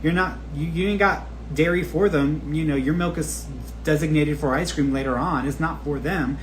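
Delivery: usual speaking voice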